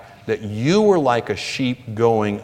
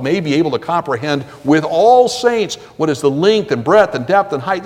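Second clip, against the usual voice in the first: louder, building preacher's reading of the verse; fervent scripture reading; 2 semitones higher, 4 dB louder than usual. Acted. Preacher reads scripture to the congregation, building fervour. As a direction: rise in fervour through the list of length, breadth, depth and height.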